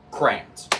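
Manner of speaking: "crayons" in one syllable, CRANS; preferred pronunciation. Shown as a demonstration. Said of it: "Not crayon".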